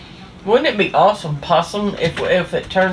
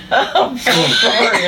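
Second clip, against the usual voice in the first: slow sorry through giggles; apologize, still cracking up; drawn-out giggly sorry; laughing apology; 3 semitones higher, slower than usual. Real, not acted.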